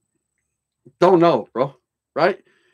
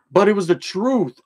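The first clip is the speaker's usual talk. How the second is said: normal talking voice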